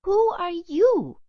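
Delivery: high, slow, wary little dog voice; Sally's question. A narrator's reading. Dog asking the ghost pirate, high and slow. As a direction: ask it slowly in a small high voice. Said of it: "asked Sally Dog Little".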